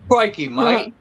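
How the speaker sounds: high, exaggerated Australian accent; a clumsy try at Aussie; a hammed-up Australian voice. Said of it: "I wish I had that accent".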